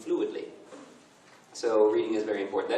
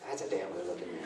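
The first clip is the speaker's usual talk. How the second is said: dropped-voice, softer concession; quiet admission; 3 semitones lower, 8 dB quieter, faster than usual. Retold or imitated. Boyfriend giving up his feigned anger, quiet grudging admission. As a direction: give in quietly, as if to yourself.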